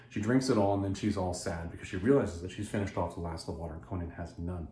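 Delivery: usual speaking voice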